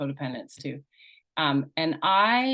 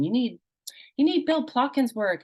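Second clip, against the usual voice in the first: ticked-off thought, pitched up sharply; irritated inner thought; 7 semitones higher than usual. Retold or imitated. Frustrated trainee grumbling at the program's missing parts work. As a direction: mutter it like you're fed up with them. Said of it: "really kind of ticked off".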